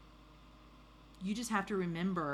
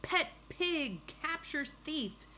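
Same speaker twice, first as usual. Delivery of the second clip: high, slow, punched headline; newspaper headline; 11 semitones higher, much slower than usual. Acted. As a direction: proclaim it like a front-page headline. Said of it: "front page of the newspaper read".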